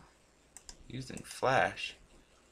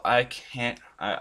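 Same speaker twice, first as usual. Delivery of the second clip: ordinary delivery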